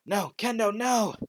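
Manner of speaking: loud high cry of panic for his friend; desperate shout. Acted. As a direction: scream her name in horror. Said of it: "as Deku just goes".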